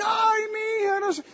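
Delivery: high, wailing cry of despair. Retold or imitated. A big man on the raft wailing in despair to his friend. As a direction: wail it like a grown man breaking down. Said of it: "empezó a llorar aquel hombre".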